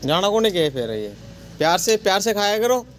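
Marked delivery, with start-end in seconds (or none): 1.6-2.9 high, coaxing voice for the monkeys; sing-song voice for a pet; coaxing pet talk. Real, not acted. Coaxing monkeys to eat gently.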